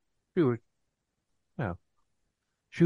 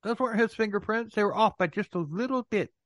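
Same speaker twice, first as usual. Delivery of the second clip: mock defensive; 8 semitones higher than usual. Playing townspeople making excuses for Bernie.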